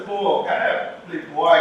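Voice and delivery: ordinary delivery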